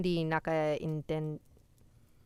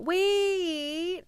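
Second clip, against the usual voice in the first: high, loud, stretched exclamation; excited surprise; 14 semitones higher, 7 dB louder than usual. Real, not acted. Streamer finding a viewer shares their background, excited squeal. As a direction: squeal it out in sudden recognition.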